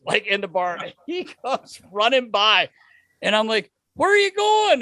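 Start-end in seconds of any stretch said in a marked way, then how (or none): 3.9-4.8 high-pitched call down the street; retold holler; calling after someone. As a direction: call after a friend running past. Calling after runner in retold story.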